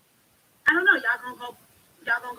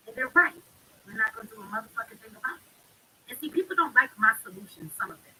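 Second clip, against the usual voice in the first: normal talking voice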